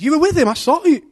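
high, loud accusation; accusing. Acted. Third accuser.

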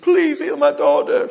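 high, loud and slow pleading; desperate plea. Retold or imitated. Parent begging Jesus for healing, loud desperate plea. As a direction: beg for your daughter's life. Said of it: "People fall at his feet".

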